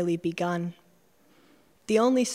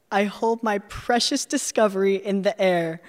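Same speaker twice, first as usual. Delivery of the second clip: plain reading voice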